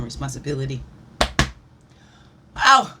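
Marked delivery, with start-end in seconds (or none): 2.6-3.0 high, very loud yelp into laughter; piercing celebratory cry and laugh; loud whoop. Real, not acted. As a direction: whoop out, then laugh loudly.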